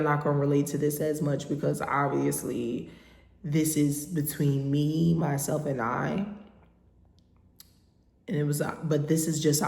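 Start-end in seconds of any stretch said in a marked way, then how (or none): none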